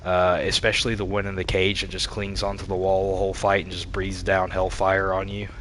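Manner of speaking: ordinary talk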